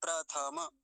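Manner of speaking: प्रथमा as "pra-tha-ma"; प्रथमा in three open syllables; full थ and म. Shown as a demonstration. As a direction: give थ and म their vowels in प्रथमा. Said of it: "थकार भी पूरा".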